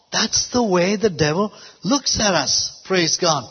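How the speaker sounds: usual speaking voice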